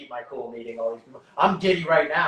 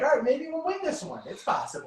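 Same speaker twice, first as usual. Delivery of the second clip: hopeful; 12 semitones higher than usual. Retold or imitated. Family's hopeful reaction at games.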